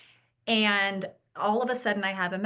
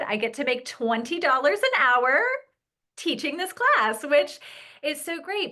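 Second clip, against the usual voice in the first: excited; 7 semitones higher, 5 dB louder than usual. Real, not acted. Excited about getting paid.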